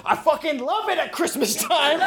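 high, gleeful burst of delight; a grown man squealing over Christmas; gushing excitement. Retold or imitated. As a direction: gush with childlike joy. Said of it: "And he goes".

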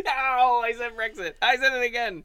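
laughing through the protest; high, loud laughing denial. Real, not acted. Caught saying the banned word.

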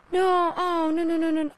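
high, loud panicked no's; gasp, then wail no in panic. Acted. Realizing the mistake went out.

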